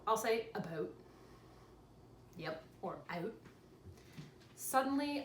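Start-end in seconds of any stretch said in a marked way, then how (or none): 0.5-0.9 Canadian vowel in "about", close to "a-boot"; "about" pronounced the Canadian way, rounded "ou"; slight Canadian accent. Shown as a demonstration. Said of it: "slightly Canadian accent". 2.4-3.3 "out" with a Canadian vowel, close to "oot"; soft, slightly Canadian "out"; slight Canadian accent. Shown as a demonstration. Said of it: "slightly Canadian accent".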